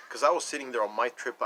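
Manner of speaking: ordinary delivery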